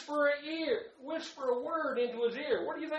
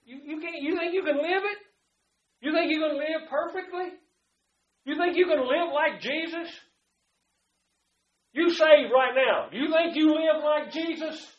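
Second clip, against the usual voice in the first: higher, pressing rhetorical challenge; challenging questions; 7 semitones higher, 7 dB louder than usual. Retold or imitated. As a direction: push back hard on the doubter. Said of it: "I said".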